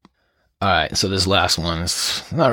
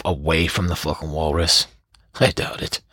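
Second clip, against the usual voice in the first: ordinary delivery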